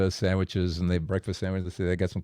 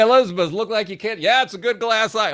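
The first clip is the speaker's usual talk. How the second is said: much higher, amazed calling to his wife; 11 semitones higher, 3 dB louder than usual. Retold or imitated. Preacher calling to his wife, amazed. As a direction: call out to Beth in disbelief. Excited exchange about glass eye.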